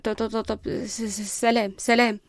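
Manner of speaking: stammering, hurried escape; nervous. Acted. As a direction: trip over the syllables and flee. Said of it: "بلعت جود ريقها بتوتر".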